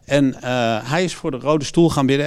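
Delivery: ordinary delivery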